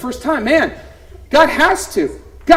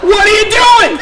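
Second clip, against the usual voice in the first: sharp shocked cry, much higher and louder; shocked shout; 9 semitones higher, 6 dB louder than usual. Retold or imitated. Mother to son after baby is hit, shocked shout. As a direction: yell it like you just saw the baby hit.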